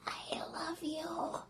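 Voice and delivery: slow, breathless whisper of last words; dying whisper. Acted. Poisoned wife whispering her last words to her husband. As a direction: let it barely escape, slowly.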